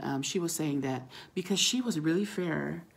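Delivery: ordinary talk, nothing marked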